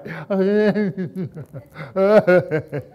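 a long, rising-and-falling loud laugh; drawn-out loud laughter. Real, not acted. Laughing at childhood memory.

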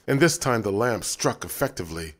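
plain reading voice

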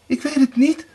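high, fearful little answer; trembling fear. A narrator's reading. Erika denies knowing about the blood, fearfully. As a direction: pitch it up like a frightened wife. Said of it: "bibberde Erika".

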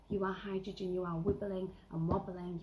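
ordinary talk, nothing marked

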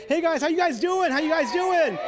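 high, loud hello to the crowd; loud greeting. Real, not acted. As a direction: call out to the crowd.

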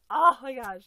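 sudden loud exclamation; loud outburst. Real, not acted. Reacting to the doll, sudden loud exclamation. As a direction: blurt it out loud.

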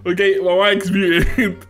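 laughing through the words; giggling in disbelief. Real, not acted. Mic chaos, laughing in disbelief.

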